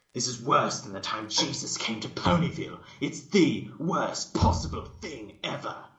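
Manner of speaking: loud, rising horror; Rarity, horrified. Acted. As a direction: recoil from the stench and cry out. Rarity reeling from the smell.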